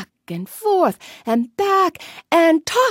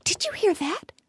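whisper; 4 semitones higher, 5 dB quieter than usual. Acted. Fish whispers in surprise.

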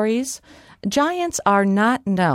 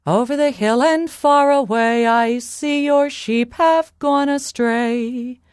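calling out; 6 semitones higher, 4 dB louder than usual. Acted. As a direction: call out from afar, holding the last words. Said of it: "Molly called out a second time".

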